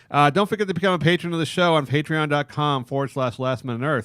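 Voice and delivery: ad read. Acted. Podcast plug read.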